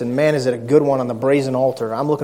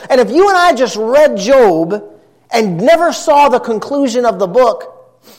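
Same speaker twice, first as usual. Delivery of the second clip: high, driving pulpit delivery; forceful preaching; 10 semitones higher, 6 dB louder than usual. Real, not acted. Preacher tells his church about Job's friends, preaching hard. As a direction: build the point with insistent, raised emphasis.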